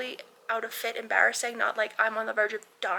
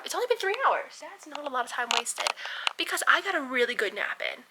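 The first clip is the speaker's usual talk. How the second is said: normal talking voice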